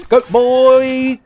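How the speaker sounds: put-on high loud goat-boy yell. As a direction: shriek it like a wild goat boy. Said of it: "You never jump in on a goat boy voice".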